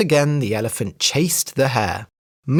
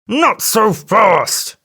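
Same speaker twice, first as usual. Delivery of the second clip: slow, loud, higher yell; elephant cry; 7 semitones higher, 5 dB louder, much slower than usual. A narrator's reading. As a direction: stretch it out as a threat. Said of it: "cried the elephant".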